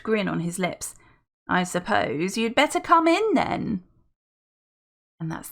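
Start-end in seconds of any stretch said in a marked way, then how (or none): 1.5-3.8 put-on posh old butler. Welby relents.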